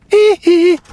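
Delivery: high squealing hee hee; [name]. Pretend. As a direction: yelp it high and loud like the pop star. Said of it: "you and [name] sort of talk similar".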